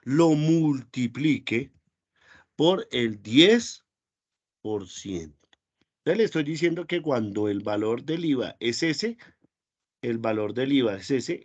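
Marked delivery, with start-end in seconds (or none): 0.0-5.5 very slow, word by word, like dictating; slow dictation. Shown as a demonstration. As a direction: spell out the formula slowly for the students. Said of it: "dictando la fórmula despacio". (dictado muy lento, palabra por palabra)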